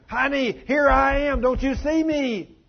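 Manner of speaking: high, loud, drawn-out desperate cry; retold screaming. Unseen husband screams in his crying wife's ear, desperate shouting. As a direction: stretch each word in an acted scream for attention. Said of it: "I'm screaming in her ear".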